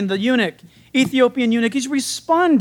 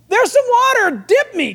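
eager Ethiopian; 10 semitones higher, 3 dB louder than usual. Retold or imitated. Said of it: "So now the Ethiopian says".

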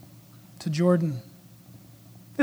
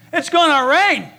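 louder higher warning; Noah warning, raised; 6 semitones higher, 5 dB louder than usual. Retold or imitated. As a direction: call out the coming rain. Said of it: "God said".

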